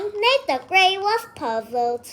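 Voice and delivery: plain reading voice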